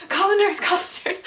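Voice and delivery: high urgent call to call the nurse; yelling at husband to call the nurse; retold yelling. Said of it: "I'm, like, yelling at my husband".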